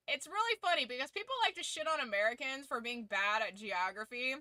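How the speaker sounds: usual speaking voice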